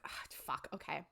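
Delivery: hushed whisper under his breath; whispered curse. Retold or imitated. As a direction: whisper it so nobody upstairs hears. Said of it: "And he's like".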